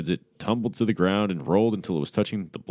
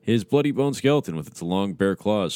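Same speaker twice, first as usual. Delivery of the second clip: plain reading voice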